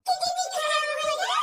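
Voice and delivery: loud, slow stunned question; shocked question. Acted. Wife hearing the kidneys failed, stunned and loud. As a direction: repeat it in disbelief.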